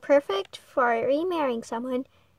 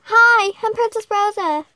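loud greeting; 7 semitones higher, 8 dB louder than usual. Acted. Princess greets prince loudly.

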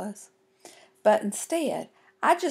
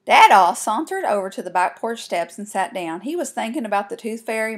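ordinary delivery

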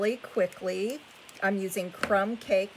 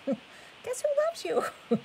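otter voice. Pretend. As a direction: stretch it out in a tiny critter voice. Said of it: "That was my best otter voice".

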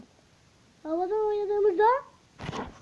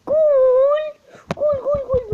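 loud, high, excited goal shout; goal celebration; 10 semitones higher, 13 dB louder than usual. Real, not acted. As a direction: yell the goals out in joy.

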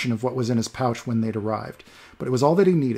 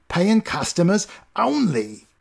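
sniveling doorman; 7 semitones higher, 3 dB louder, slower than usual. Acted. Doorman refusing entry.

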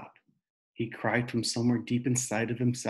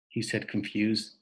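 ordinary delivery

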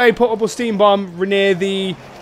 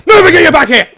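loud, raised shout; urgent call; 7 semitones higher, 7 dB louder than usual. Real, not acted. Player orders a teammate back, urgent shout.

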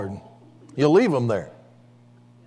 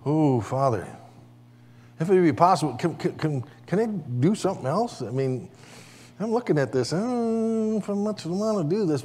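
a hint of a hesitant, halting plea, softer; mild mock reluctance, hushed; reluctant prayer; 2 semitones higher, 5 dB quieter than usual. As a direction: stammer it out, unwilling. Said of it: "he goes".

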